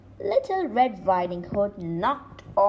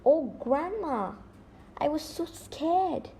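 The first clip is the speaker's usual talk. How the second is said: mild sob; 6 semitones higher than usual. Acted.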